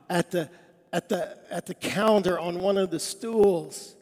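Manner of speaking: usual speaking voice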